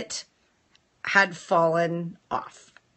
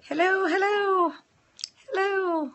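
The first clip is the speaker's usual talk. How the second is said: high lilting hello, hello; sing-song greeting; 10 semitones higher, 3 dB quieter than usual. Acted. Host greeting viewers joining the stream, sing-song high. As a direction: call out a cheery greeting.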